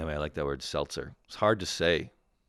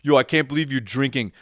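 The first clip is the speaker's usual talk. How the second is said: loud hammed-up snobby friend; mocking the snob; 3 semitones higher, 7 dB louder than usual. Host mocks his fruity-beer friend scoffing at Budweiser, loud mock sneer. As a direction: sneer it like a know-it-all. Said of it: "how much of a fucking knob you sound like".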